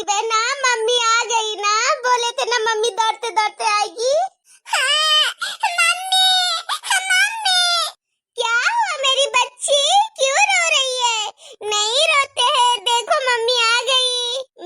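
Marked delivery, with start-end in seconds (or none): 4.7-7.6 put-on child crying out to mother. Child cries seeing mother arrive. 8.4-14.7 comforting mother. Acted. Mother comforting crying daughter.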